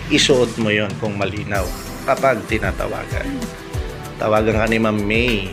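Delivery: ordinary delivery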